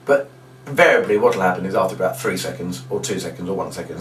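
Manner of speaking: usual speaking voice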